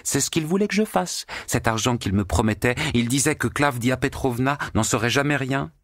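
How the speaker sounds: high, mocking, scandalised telling; gossiping maid. A narrator's reading. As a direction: relish every detail. Natasha continues her gleeful tale.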